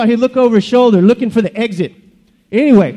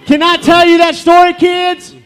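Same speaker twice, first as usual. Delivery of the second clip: high, loud call to the crowd; rousing call; 7 semitones higher, 3 dB louder than usual. Acted. Bernie the bush re-asks unexcited kids, rousing high shout. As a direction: ask it big to fire up the kids. Asks again for more excitement.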